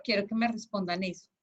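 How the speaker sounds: ordinary talk, nothing marked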